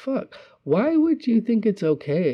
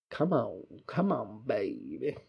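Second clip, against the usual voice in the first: coaxing murmur; 4 semitones lower, 8 dB quieter than usual. Retold or imitated. Quoting the video, softly.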